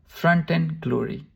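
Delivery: ordinary delivery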